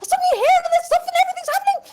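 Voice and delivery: high, fast, frantic lady; frantic noblewoman rant. Acted. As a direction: gabble it in a panic.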